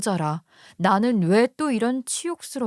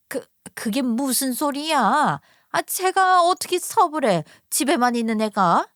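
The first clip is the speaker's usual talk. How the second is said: put-on stammering, higher and louder, incredulous older woman; stunned disbelief; 3 semitones higher, 3 dB louder than usual. Mother-in-law hearing her daughter-in-law earns money, disbelieving. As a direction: sputter in disbelief.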